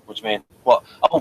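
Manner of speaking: ordinary talk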